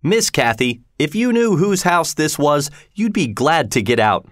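angry warning without real shouting; mild angry shout. A narrator's reading. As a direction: scold with a touch of anger. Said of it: "I shouted angrily".

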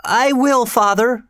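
put-on very high, pleading boy voice; high-pitched frightened obedience; weak cry. A narrator's reading. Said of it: "He fell back with a cry".

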